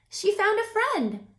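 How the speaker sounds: ordinary delivery, nothing marked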